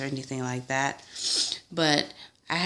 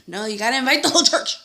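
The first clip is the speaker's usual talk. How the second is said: parents insisting; 8 semitones higher, 5 dB louder, much faster than usual. Retold or imitated. Voicing her parents' insistence.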